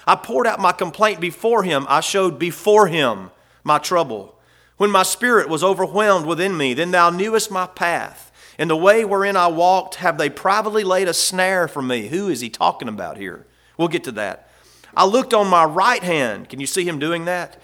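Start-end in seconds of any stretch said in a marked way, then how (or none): 0.1-12.1 higher, louder preached scripture reading; preaching the psalm. Acted. 14.9-16.5 higher, louder preached scripture reading; preaching the psalm. Acted.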